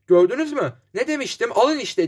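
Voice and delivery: high-pitched glee, mildly exclaimed; narrated triumphant I-told-you-so; joyful cry. A narrator's reading. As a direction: crow with delight, pitch lifted, without shouting. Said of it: "diye sevinçle haykırdı Lecter".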